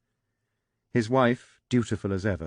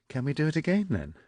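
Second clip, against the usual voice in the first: put-on playful young woman; 8 semitones higher, faster than usual.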